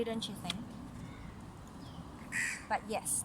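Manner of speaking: usual speaking voice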